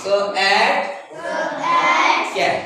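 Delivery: "So at" in loud separate sounds, then "Cat" as one word; loud phonics drill blending into "Cat"; sounding out, blending. Shown as a demonstration. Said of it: "This way".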